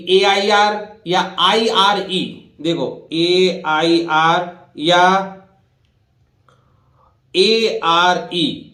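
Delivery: letters AIR and are drawn out very slowly; slow spelling. Shown as a demonstration. Teacher spelling letter groups slowly for pupils. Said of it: "उच्चारण मैंने बताया था".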